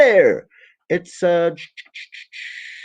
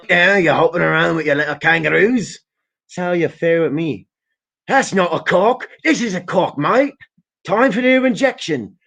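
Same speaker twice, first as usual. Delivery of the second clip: higher mock Australian accent; hammed-up Australian accent, getting higher; mock Aussie; 4 semitones higher than usual. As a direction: play a cocky Australian bloke. Mocking Australian with kangaroos.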